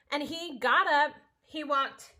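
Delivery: normal talking voice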